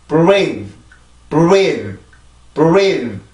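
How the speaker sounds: "breathe" with B leading into a rounded R, like "buweedh"; long stressed "breathe" with B before the R glide; B put back. Shown as a demonstration. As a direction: add the B onto rounded "reathe". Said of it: "비발음이 들어가죠".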